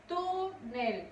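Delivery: "Túnel" with a long TÚ, then falling NEL; two separated syllables in "Túnel", weight on the first; stressed first syllable. Shown as a demonstration. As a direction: hold the TÚ of "Túnel". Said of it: "Su sílaba tónica es tu".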